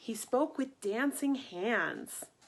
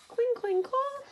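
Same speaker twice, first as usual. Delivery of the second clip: clashing swords; 13 semitones higher than usual. Acted. Knights puzzled.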